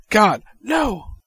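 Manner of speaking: high, loud mock horror; Michael Scott "God, no". Reading a gif quote aloud, loud mock horror. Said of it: "the Michael Scott gif of".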